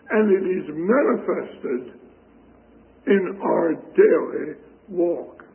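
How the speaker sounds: normal talking voice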